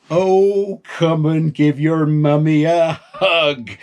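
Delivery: a mum gushing over her grown son; doting mum. Acted. Mother greets son.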